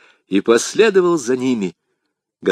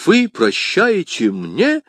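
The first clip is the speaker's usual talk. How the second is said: slow, higher-pitched incredulous question; возмущённое восклицание; 7 semitones higher, slower than usual. A narrator's reading. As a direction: exclaim it word by word, pitch leaping up. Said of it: "воскликнул Д'Артаньян". (медленное изумлённое восклицание)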